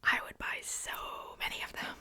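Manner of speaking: normal talking voice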